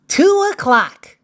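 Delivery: high, bright-pitched call of the hour; lifted, announced clock time; time announcement. Acted. Calls out story time.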